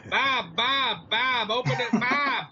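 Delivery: lightly voiced woman yelling for Bob; imitated girlfriend calling. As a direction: call for Bob like the girlfriend outside. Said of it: "She's yelling up at the windows at him".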